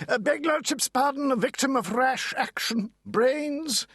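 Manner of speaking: high, clipped barrister's reply; lightly voiced lawyer, pitched high; pompous lawyer. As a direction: answer like a self-important counsel.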